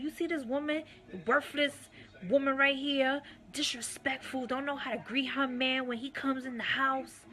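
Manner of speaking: high, scornful imitation of the husband; degrading mock tirade. Husband mocking his wife in front of Ayasha Allah, high sneering imitation. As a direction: pitch it up and sneer at her. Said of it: "degrading me in front of Ayasha".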